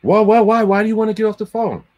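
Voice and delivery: panicked corporate voice; mock flustered brand guy. Brand guy begging him to stay, high and flustered. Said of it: "I was like".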